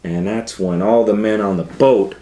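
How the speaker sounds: ordinary delivery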